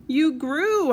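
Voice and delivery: high, loud startled shout; yelled. Acted. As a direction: blurt it out in shock. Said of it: "I yelled".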